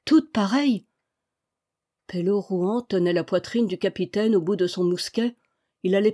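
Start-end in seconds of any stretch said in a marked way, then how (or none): 0.1-0.9 higher-pitched cry of delight; delighted, lifted exclamation; childlike joy. A narrator's reading. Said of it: "avec une joie d'enfant".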